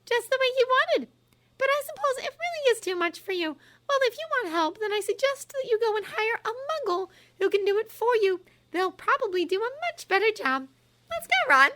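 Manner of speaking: high, pressing, unrelenting; relentless. A narrator's reading. Hermione relentlessly pressing Umbridge, high voice. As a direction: keep pushing without mercy.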